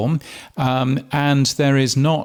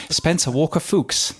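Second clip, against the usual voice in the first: put-on posh accent; 4 semitones higher than usual. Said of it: "I'm guessing he's quite posh".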